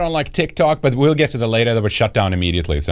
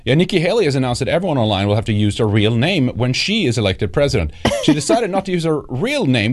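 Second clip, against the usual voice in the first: ordinary talk, nothing marked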